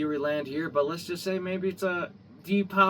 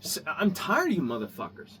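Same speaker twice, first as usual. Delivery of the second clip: ordinary talk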